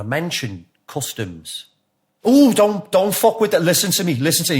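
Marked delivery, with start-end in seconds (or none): 2.2-4.6 high, loud urgent warning; really intense. Retold or imitated. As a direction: press the warning hard, voice pitched right up. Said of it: "He's really intense".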